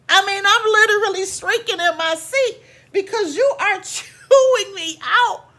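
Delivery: high, loud, laughing through it; laughing exasperation. Real, not acted. Narrator recounting being chewed out, laughing and high. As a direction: laugh out the outrage.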